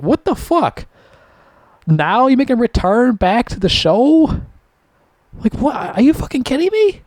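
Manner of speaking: very high, disbelieving protest; incredulous outrage. Retold or imitated. Fan reacting to old characters' return, incredulous. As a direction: replay your disbelief in high-pitched questions.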